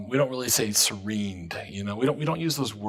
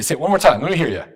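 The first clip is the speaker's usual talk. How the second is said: ordinary talk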